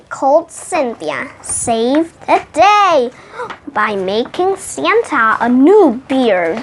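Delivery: usual speaking voice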